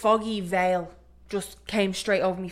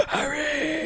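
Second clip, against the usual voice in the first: gasped, higher mock Voldemort; Voldemort hiss; 7 semitones higher, 4 dB quieter than usual. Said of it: "Voldemort talks to Harry".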